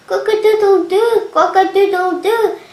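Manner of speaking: loud high crow; rooster crowing. A narrator's reading. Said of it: "And the cock crowed".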